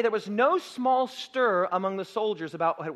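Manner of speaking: ordinary delivery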